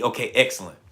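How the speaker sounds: ordinary delivery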